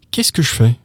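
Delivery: normal talking voice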